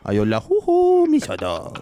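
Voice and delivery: squeaky high, loud goofy voice; Adam Sandler voice. Acted. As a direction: go squeaky and silly for the dog. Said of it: "I'll snap into that voice".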